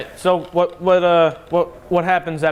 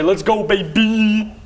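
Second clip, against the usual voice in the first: drawn-out heroic rallying cry; slow hype shout; 4 semitones higher, much slower than usual. Acted. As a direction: stretch it out like a victory call. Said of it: "I say".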